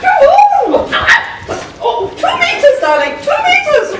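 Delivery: laughing shriek keeping the outlaws away; squealing panto dame. Acted.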